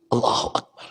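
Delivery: softly breathed Allahu Akbar; hushed awe; breathy whisper. Real, not acted. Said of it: "breathed exclamation after story".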